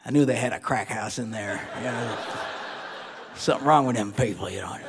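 put-on low, muttered old-timer grumble; old neighbors. Old people gossiping at the window, low suspicious grumble. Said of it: "the old people across the street looking out the window going".

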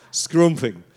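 SCRUMPING with a northern "oo" in the first syllable; northern English accent. Shown as a demonstration. Showing how they say it up north, northern English accent. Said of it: "if you come from up north".

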